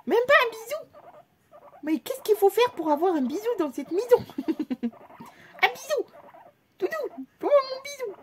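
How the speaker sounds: hammed-up begging voice; whiny pet baby talk. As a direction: plead like a spoilt child.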